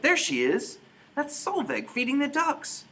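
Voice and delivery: high, loud, amazed shout; a father crying out as he spots his lost girl. Acted. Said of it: "her father shouted".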